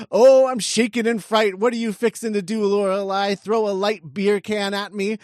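high, loud put-on leprechaun sarcasm; mocking leprechaun. Leprechaun Billy taunts Lorelai in the script, mocking high voice. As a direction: feign fright in a leprechaun voice. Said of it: "And Billy says".

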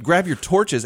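high, loud hammed-up British accent; a badly faked British counselor accent; terrible British accent. Counselor calling campers to the campfire, bad British accent. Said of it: "terrible British accent".

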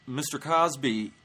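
ordinary talk, nothing marked

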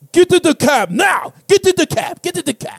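put-on high, urgent African-accented cab driver. Cab driver urging a lone passenger in, high African accent. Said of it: "I'm doing an African voice".

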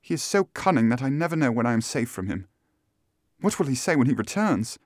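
high, fretful woman's voice; frightened Miss Stoner. Acted.

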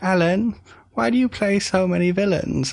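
put-on high, childlike asking voice; curious kid. A host's child asking Alan Rickman at dinner, high and childlike. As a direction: pitch it up like a kid asking an adult. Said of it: "One of the host kids was like".

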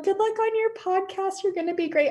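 high-pitched imitation of a supportive partner; partner's cheery send-off. Partner wishing her luck before the podcast, cheery imitation. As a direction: lift the voice high for a sing-out good luck.

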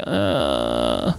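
a breathy, worn-out groan of a plea; weary plea. Retold or imitated. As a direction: sound drained and begging it to end. Worn out at a hot concert.

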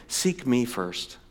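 tender quote. Retold or imitated. Quoting Jesus softly.

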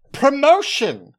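high, loud cry of the word; excited shout; triumphant shout. Acted. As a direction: blurt it out like a winning idea. Goblin shouts a bribe.

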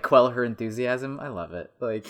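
normal talking voice

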